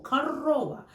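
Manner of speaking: корова said karOva, the first O as A and the second O stressed; корова with the stress on the middle O; Russian корова. Shown as a demonstration. Teacher models the stressed O for learners. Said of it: "first O, sounds like A".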